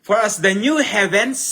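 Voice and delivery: fervent preached phrase; preaching emphasis. Acted. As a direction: ring out the phrase. Repeats verse raised.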